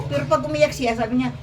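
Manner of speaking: usual speaking voice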